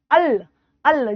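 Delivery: अल, अल drawn out slowly with a curled tongue; teacher spells out अल slowly; slow demo al. Shown as a demonstration. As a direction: slowly repeat अल with a retroflex flap. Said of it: "जब भी हम अल बोलेंगे".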